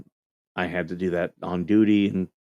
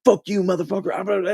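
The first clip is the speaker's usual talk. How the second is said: high mock-shouted insult down the phone line; imitating a drill instructor snapping back, higher pitched; angry drill instructor; 11 semitones higher than usual. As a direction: play the furious voice on the other end, hammed up. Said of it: "this voice on the other line's like".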